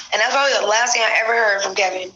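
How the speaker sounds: ordinary talk, nothing marked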